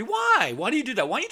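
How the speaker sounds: very high incredulous why; exasperated why. Real, not acted. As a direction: throw it up in disbelief. Exasperated rant on previews.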